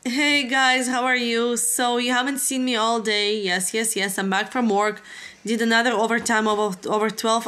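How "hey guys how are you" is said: ordinary talk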